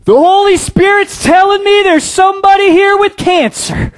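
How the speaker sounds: high, loud, hammed-up preacher's shout; faith healer proclaiming. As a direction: boom it out like a showy faith healer.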